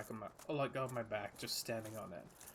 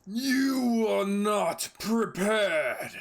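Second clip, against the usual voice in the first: huge, slow booming demon roar; Illidan voice; 8 semitones higher, 14 dB louder, much slower than usual. Acted. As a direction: bellow it slowly like a demon lord. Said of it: "that was a little weak".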